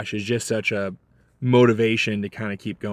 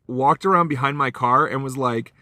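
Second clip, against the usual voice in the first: ordinary talk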